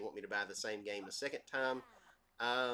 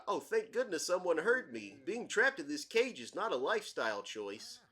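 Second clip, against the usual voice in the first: higher-pitched, swooping cartoon character voice; Bullwinkle; 8 semitones higher, 5 dB louder than usual. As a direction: play a goofy talking book, pitched up and louder. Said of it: "a Bullwinkle voice".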